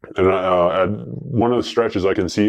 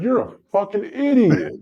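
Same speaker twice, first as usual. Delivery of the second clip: exasperated buddy; 11 semitones higher than usual. Retold or imitated. Buddy scolds friend in retold story.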